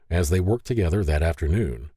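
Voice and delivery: usual speaking voice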